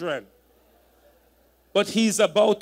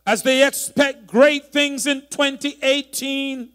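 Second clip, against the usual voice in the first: louder preaching prayer; prayer blessing; 4 semitones higher, 5 dB louder than usual. Real, not acted. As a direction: pray the blessing out with conviction. Pastor prays blessing over congregation.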